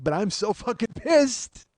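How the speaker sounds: usual speaking voice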